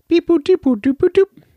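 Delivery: high-pitched phone-dialing boops; imitated dialing beeps. Host demonstrating a call to the hotline, mock dialing beeps. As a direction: sing out fake keypad tones, very high. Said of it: "I'll say it like this".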